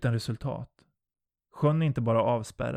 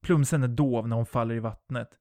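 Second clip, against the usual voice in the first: plain reading voice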